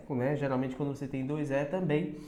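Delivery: ordinary talk, nothing marked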